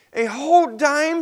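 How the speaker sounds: put-on high, loud disbelieving child; shocked. Peter shocked he must pay Grandma a whole dime. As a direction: gasp it out in disbelief.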